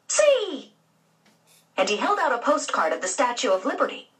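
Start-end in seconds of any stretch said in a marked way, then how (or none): 0.1-0.7 put-on high, bright little-boy exclamation; proud little kid showing off; excited child. Little boy eagerly showing George a postcard, high and excited.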